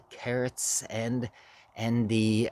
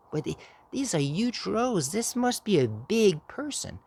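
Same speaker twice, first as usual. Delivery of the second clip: put-on high, awed child; a small kid amazed at huge rows; little kid voice; 9 semitones higher than usual. Child marvelling to grandma about the helper, high and awed.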